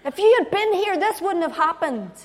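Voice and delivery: loud, high accusation; brutally honest outburst. Acted. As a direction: hurl the blame at full voice. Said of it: "She's brutally honest".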